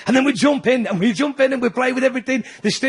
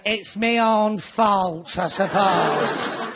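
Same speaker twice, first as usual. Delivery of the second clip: very slow, deflated, resigned drone; gloomy Mancunian shrugging off cancer; resigned gloom; 3 semitones lower, much slower than usual. Retold or imitated. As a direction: accept the bad news with a shrug. Miserable Mancunian reply.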